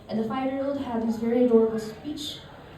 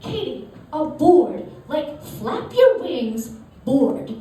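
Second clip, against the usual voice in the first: put-on high, loud exasperated kid with Southie accent; frustrated kid; 5 semitones higher, 5 dB louder, much slower than usual. Cousin explaining "board" to babysitter, frustrated. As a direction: drag it out in mock exasperation. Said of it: "he just gets so more frustrated".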